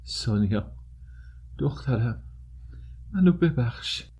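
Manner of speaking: a dying father's strained call, without volume; anguished cry. A narrator's reading. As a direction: cry out weakly, word by word, pleading. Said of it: "فریاد زد".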